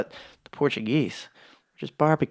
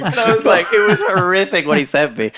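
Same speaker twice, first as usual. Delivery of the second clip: higher and louder through laughter; laughing; 6 semitones higher, 5 dB louder than usual. Real, not acted. Laughing at the horrific meme his friend sent. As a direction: keep laughing while telling it.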